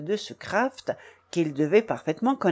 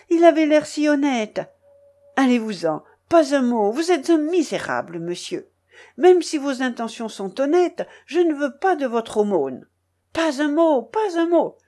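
put-on higher, forceful outraged young woman; furious accusation; 6 semitones higher, 4 dB louder than usual. A narrator's reading. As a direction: cut him off in rising indignation. Furious accuser silencing and insulting him.

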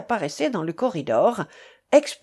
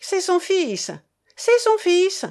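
high-pitched, emphatic insistence; insistent repetition; 10 semitones higher, 3 dB louder than usual. A narrator's reading. Man repeating who the boy is to two women, high insistence. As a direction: keep pressing the same claim, high and urgent. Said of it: "répétait-il sans ajouter aucune explication".

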